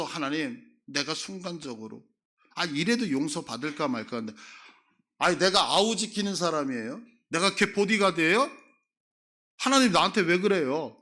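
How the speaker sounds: loud, rising, indignant back-talk; shameless retort. Retold or imitated. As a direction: sound affronted, as if God wronged you. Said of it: "이렇게 뻔뻔스럽게 얘기한다고요".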